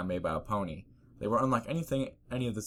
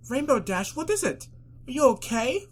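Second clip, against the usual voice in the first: high, loud, alarmed; alarmed posh pony; 6 dB louder than usual. Acted. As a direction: cry out in worry. Rarity alarmed.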